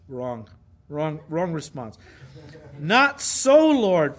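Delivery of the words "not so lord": "Not so, Lord" loud, "so" swooping high; hammed-up, forceful refusal on "Not so, Lord"; emphatic. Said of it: "In the Greek, it's emphatic".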